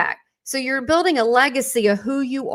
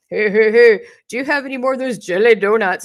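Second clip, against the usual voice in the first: loud, goofy [name]-style voice on an answering machine; Larry Sprinkle's [name] voice; 8 dB louder than usual. Retold or imitated. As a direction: belt it out like a showman doing [name]. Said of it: "he sounded just like [name] 'cause he did voices".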